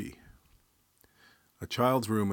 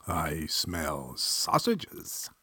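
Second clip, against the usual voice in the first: very slow, drawn-out relish; comically drawn-out announcement; savouring; 2 semitones lower, much slower than usual. Acted. Devil sniffs the air.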